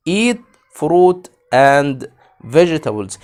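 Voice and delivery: Eat fruit and vegetables read very slow and loud, EAT stressed; slow together. Shown as a demonstration. Teacher asking kids to read slowly along with her. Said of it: "معايا كده بالراحة".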